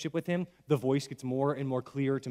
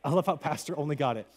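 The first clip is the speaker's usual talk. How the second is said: ordinary delivery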